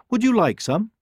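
high bright hen; offering soup kindly; cheerful hen. Acted.

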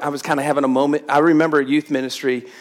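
normal talking voice

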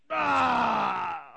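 loud exasperated "ah"; frustrated yell. Retold or imitated. Parent finding practice is on another day, frustrated yell.

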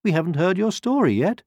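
high, loud, protesting hen; hen character. A narrator's reading. Hen asking the departing wolf to stay, protesting. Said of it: "said the hen".

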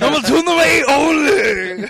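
drawn-out high shouted imitation; mock yell. Friend teasing a drive-thru story, mock yelling. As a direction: bellow it, stretching the words out.

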